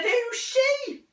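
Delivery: high, slow and probing; mother's voice. Acted. Mother checking on son, high and slow. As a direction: lift the voice into the mother asking.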